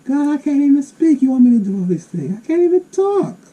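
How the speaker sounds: high, loud pleading Moses; a high, loud, whining excuse from Moses; whiny Moses. Acted. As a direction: plead like a panicked Moses who can't speak. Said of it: "Moses is saying".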